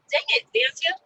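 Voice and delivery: high, loud cry breaking through; half-laughing through tears; tearful outburst. Real, not acted. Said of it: "you're gonna have me start crying".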